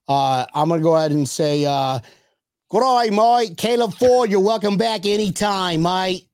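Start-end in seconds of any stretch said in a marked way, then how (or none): 2.7-6.3 put-on British accent, pitched higher at the start; cheery mock-British greeting. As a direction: do an amateur, playful British accent. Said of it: "In your British accent".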